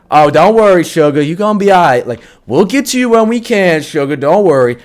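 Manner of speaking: patronizing Southern-ish sweetness; mock sweet nurse. Nurse brushing off an injured patient, mock sugary. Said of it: "the fucking nurse is like".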